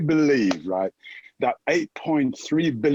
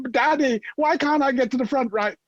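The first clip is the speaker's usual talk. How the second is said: put-on whiny child; 9 semitones higher than usual. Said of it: "someone in your ear saying".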